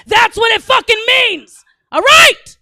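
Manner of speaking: high, very loud, exasperated shout; comic exasperated yelling; emphatic yell. Real, not acted. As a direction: drive the point home at full volume. Yells her point at Toyota.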